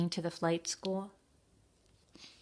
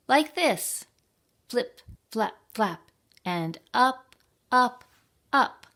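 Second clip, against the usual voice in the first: eager flying demo; 6 semitones higher, 5 dB louder than usual. Acted. Teacher demonstrates flying to birds.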